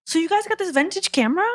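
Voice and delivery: high-pitched mock-curious question; imitating bride's mom. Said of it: "she's like".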